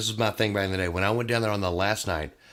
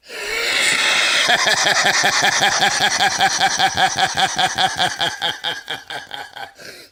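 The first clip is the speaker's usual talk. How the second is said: long high loud cackling laugh imitating Kevin; Kevin McCreary laugh; 11 semitones higher, 8 dB louder than usual. Doing Kevin's laugh for the podcast host, long high cackle. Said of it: "let me do my Kevin McCreary impersonation".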